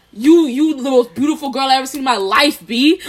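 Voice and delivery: loud, mock-aggressive New Yorker; angry-sounding compliment. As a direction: bark sweet words as if picking a fight. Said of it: "angry at you".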